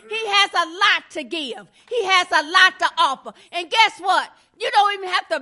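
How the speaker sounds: full-voiced sermon push; preaching. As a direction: press the congregation harder and harder. Preaching builds in volume.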